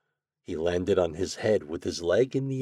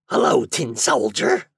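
sly imp voice, louder; 3 semitones higher, 6 dB louder than usual. A narrator's reading. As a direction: impish creature greeting. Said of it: "said the imp".

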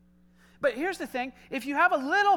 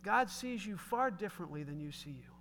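usual speaking voice

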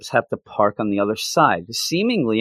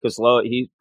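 normal talking voice

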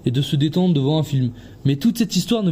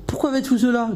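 worried; 8 semitones higher than usual. Acted. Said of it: "d'une voix inquiète".

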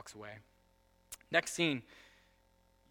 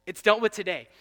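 emphatic shout; 7 semitones higher, 15 dB louder than usual. Acted. Loud repeat.

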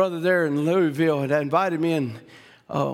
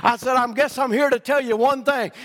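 raised, fervent telling; rising preacher's insistence; preaching to her; 7 semitones higher than usual. Retold or imitated. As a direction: declare it to the sister. Preacher answering the woman.

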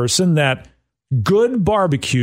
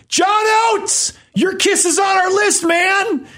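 high-pitched re-enacted yell; loud shout. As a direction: holler it out like calling across a dinner table. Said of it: "I look at him and loudly say".

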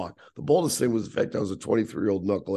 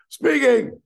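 very high, loud mimicked phone yell; yelling, hard of hearing; 8 dB louder than usual. Half-deaf club boss to young caller, yelling. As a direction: holler it like you can't hear yourself. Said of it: "he's yelling at me 'cause he's sort of deaf".

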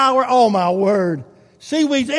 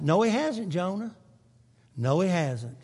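tender reassurance; 3 semitones lower, 6 dB quieter than usual. Real, not acted.

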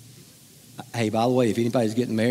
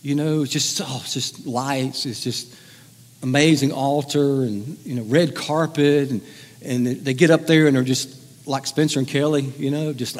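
ordinary talk

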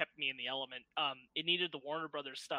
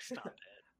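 whispered; 10 dB quieter than usual. Retold or imitated. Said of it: "And I'm like".

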